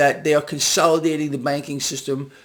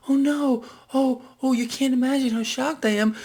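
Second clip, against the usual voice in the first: mock shock; 7 semitones higher than usual. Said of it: "imagine my shock".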